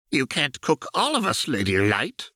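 high, amused villain voice; a gleeful sneering baron, pitched high. Acted. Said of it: "his voice pitched high with amusement".

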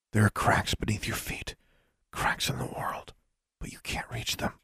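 soft, voiceless secret; hushed, confidential revelation; whisper. A narrator's reading. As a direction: whisper it close, luring the listener in. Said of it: "spoke in a whisper".

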